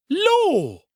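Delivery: high, loud cry of alarm; startled exclamation. A narrator's reading. Cook and housemaid hearing of the noise, startled exclamation. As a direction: exclaim high, drawing the word out. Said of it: "cook and housemaid simultaneously ejaculated".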